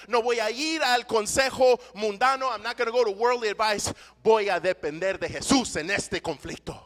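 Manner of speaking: preacher declaring resolve to the church; full-force preaching. Acted. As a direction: preach it with fire.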